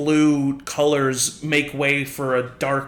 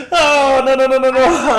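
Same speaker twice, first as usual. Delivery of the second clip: loud, high panicked denial; a wizard losing control, crying out; 9 semitones higher, 7 dB louder than usual. Acted. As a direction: Edmund yelping no as he changes. Edmund panicking mid-transformation.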